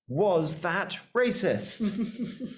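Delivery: slow, high announcer title; game show title. Pretend. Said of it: "our new favorite game".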